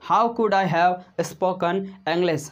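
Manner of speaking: very slow, stretched English; teacher modelling slowly; slow model sentence. Shown as a demonstration. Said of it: "practice कर सकते".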